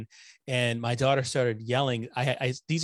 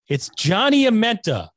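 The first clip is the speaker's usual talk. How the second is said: loud, high guest introduction; excited announcement; 10 semitones higher, 9 dB louder than usual. Real, not acted. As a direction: announce him like a game show host.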